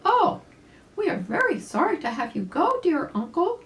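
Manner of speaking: put-on high, loud little girl voice; little girl rabbit. Little Susie saying goodbye to her uncle, high and sorry. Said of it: "said Susie Littletail".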